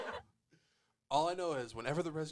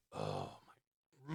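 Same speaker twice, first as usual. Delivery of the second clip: low, drawn-out "oh my"; deep mock dismay; 14 semitones lower, 4 dB quieter than usual. Ashley dying in one hit, low deadpan dismay. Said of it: "it's just like".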